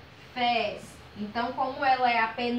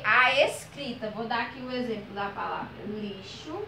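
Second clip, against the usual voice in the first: normal talking voice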